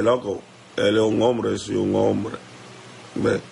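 ordinary delivery

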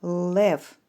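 лев pronounced emphatically as a single syllable; syllable demo. Shown as a demonstration. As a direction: say лев loud and firm as one beat. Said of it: "Підкладіть долоньку та промовте".

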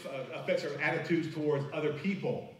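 ordinary talk, nothing marked

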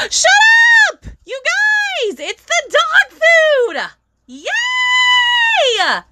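high, drawn-out squeal of delight; excited scream. Real, not acted. Streamer opens the dog food mini, real excited scream. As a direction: shriek it, stretching the last words right out. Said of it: "Sorry if I screamed really loud".

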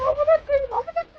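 very high, loud frustrated cry; shrieking curse. Real, not acted. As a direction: squeal the curse in disbelief. Gamer swears at opponent's move.

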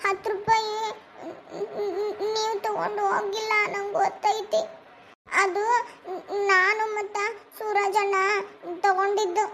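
halting, tearful confession; slow broken phrases with long sobbing pauses; crying. Acted. Child confesses taking money to father, crying. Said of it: "ಯಾಕ್ ಅಳಕತ್ತೀವ".

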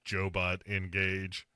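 mock robot dad, evenly spaced words; deadpan Joe-bot voice. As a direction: announce it like a robot powering up. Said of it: "he's been replaced by a robot".

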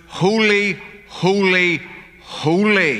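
"Holy, holy, holy" with each holy set apart and stressed, the first two pitched high; "Holy, holy, holy" as three weighty, separate proclamations; threefold emphasis. Shown as a demonstration. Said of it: "is the highest emphasis".

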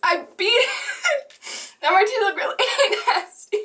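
high, fast, giggling triumph; laughing. Real, not acted. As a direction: laugh out the victory. Triumphant after swallowing.

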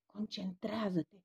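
soft, slow, intense urging; slow hushed plea to focus; passionate whisper. A narrator's reading. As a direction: hush the medium urgently. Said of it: "șopti cu patimă Paulian".

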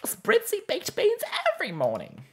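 high, loud, goofy cartoon moose; dopey cartoon moose, pushed up high; Bullwinkle moose voice. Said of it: "[name]'s voice is very much like a Bullwinkle moose voice".

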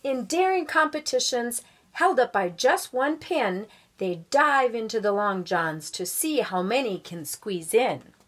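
put-on lively read-aloud voice for children; storybook reading. A narrator's reading. Guest reader reading a picture book to schoolchildren, lively storybook voice.

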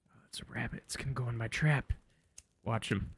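hushed, barely voiced; quick excited whisper at a rabbit. Real, not acted. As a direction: whisper so the rabbit won't hear.

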